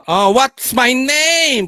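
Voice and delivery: high, drawn-out mock taunt; slow hammed-up questioning; mock demanding. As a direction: stretch each word out, teasing and insistent. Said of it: "Parang ganun".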